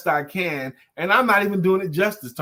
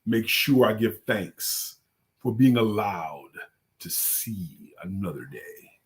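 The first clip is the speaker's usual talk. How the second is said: Mark Greer's voice; 5 semitones lower than usual. Retold or imitated. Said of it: "I'm doing Mark Green's voice".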